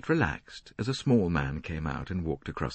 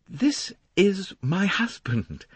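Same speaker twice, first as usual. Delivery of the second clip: faint tremor; 13 semitones higher, 3 dB louder, much slower than usual. A narrator's reading. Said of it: "A faint tremor in the voice".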